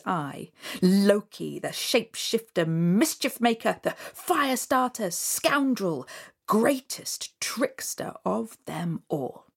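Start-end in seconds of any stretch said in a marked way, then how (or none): none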